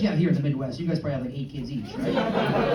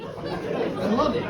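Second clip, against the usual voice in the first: usual speaking voice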